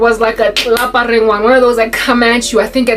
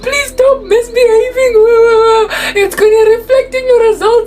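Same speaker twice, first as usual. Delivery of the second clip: high sing-song wail with long mock sobs; hammed-up tearful teacher, trailing into cries; Missus Pepper impression; 12 semitones higher, 3 dB louder than usual. Student looping teacher's warnings, high mock bawling. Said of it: "we would just do this".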